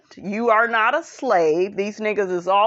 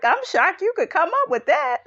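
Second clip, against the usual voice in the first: ordinary talk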